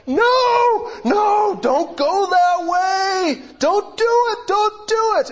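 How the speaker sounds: high, loud pleading yells of fans; sideline shouting. Retold or imitated. Fans on the sidelines yelling at their own player, loud high shouts. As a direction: beg the player not to go that way, shouting. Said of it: "people on the sidelines going".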